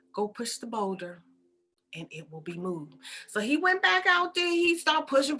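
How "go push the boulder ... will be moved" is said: soft, low, unhurried command; patient, hushed authority; calm divine instruction. Acted. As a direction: say it quietly as God. God calmly repeats his instruction.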